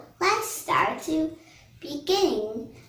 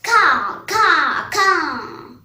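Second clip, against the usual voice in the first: loud drawn-out imitated crow caws; crow cawing; 3 semitones higher, 9 dB louder, much slower than usual. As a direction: call out like a crow. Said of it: "Monkey calls a crow".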